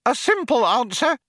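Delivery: high-pitched, eager hope; excited wonder. Acted. Second programmer adds to his colleague's question, excited wonder. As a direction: chime in excitedly.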